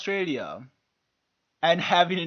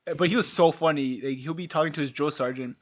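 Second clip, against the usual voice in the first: ordinary talk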